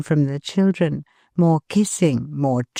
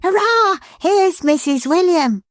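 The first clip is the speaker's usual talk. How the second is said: excited cry; 13 semitones higher than usual. Acted. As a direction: cheer her arrival. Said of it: "cried Johnny".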